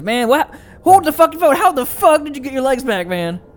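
high, loud, incredulous yell; shocked outburst. Acted. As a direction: burst out in baffled disbelief. In-character shock at regrown legs.

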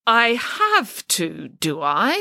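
slow, lightly menacing question. Acted. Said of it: "starting to sound menacing".

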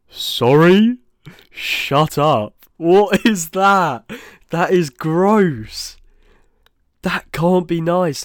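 loud, high, drawn-out disgust; outraged disbelief. Real, not acted. Host reacting to chocolate on cheese pizza, appalled. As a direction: recoil in appalled disbelief, voice shooting up.

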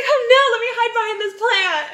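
high, laughing mock damsel voice; mock scared victim. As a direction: ham up a silly helpless girl. Mocking the victim's line.

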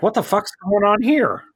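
a mock outraged what-is-this; baffled. Viewer reacting to the ending, high and incredulous. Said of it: "I was like".

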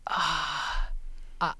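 ordinary talk

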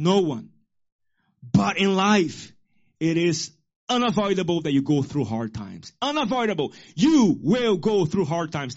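loud, rising preacher cadence; full-force preaching. Acted. Pastor preaching to congregation, fiery sermon voice. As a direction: proclaim it to the church.

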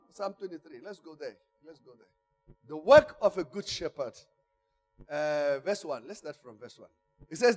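ordinary delivery

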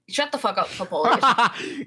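stretching the name out through a laugh; laughing scold. Real, not acted. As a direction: tell him off while cracking up. Laughing at friend's interruption.

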